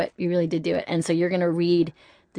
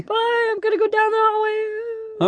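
calling from far off. Pretend. Calling out while walking away.

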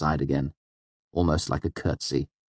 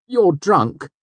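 Sim voice; 13 semitones higher, 6 dB louder than usual. A narrator's reading.

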